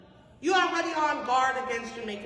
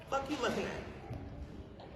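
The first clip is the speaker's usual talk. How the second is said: rude retort; 9 dB quieter, slower than usual. Acted. Acts out rude reply to greeting.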